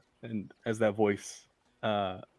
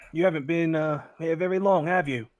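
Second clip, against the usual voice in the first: higher, louder, probing voice; suspicious constable; 8 semitones higher, 9 dB louder than usual. Acted. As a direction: size up the strangers.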